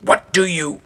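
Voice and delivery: high raspy challenge, cut off; annoyed raspy man at the door. A narrator's reading. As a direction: rasp it irritably, then break off. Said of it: "The raspy voice stops upon recognition".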